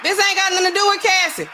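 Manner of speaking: family laying down the law, loud and high; mock family scolding. Family members scolding at intervention.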